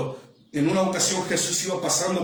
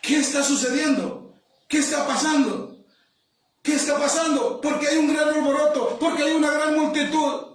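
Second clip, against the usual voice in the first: raised-pitch, insistent asking; higher, urgent questions from a bystander; puzzled questioning; 9 semitones higher than usual. Acted. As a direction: act out the blind man asking about the commotion. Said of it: "él pregunta y dice".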